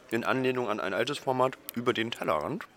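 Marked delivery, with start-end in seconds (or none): none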